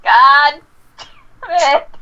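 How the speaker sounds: loud, high exasperated curse; shouted out loud. Acted. Player's character cursing after muttering, loud exasperated shout. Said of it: "Shouts out loud".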